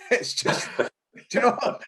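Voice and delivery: laughing through the words; amused, laughing. Real, not acted. Retelling a reply to a worried instructor, laughing. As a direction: chuckle while brushing off the worry.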